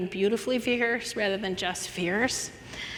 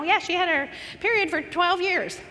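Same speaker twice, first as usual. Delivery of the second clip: mock casual shrug-off; breezy high mocking tone; dismissive; 7 semitones higher than usual. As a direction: brush it off lightly, like it's nothing. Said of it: "I mean, not like".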